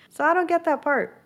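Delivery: can't believe what she's seeing; baffled outburst. Real, not acted. Recapping the show to viewers, incredulous high-pitched outburst.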